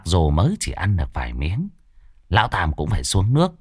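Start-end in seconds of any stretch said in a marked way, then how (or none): none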